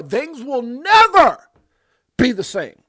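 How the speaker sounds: "never" held long, high and very loud; slow, thundering proclamation peaking on "never"; heavy stress on "never". Real, not acted. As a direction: shout "never" slowly, then pause before the rest. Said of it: "I can't even stress the word never".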